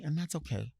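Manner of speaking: ordinary talk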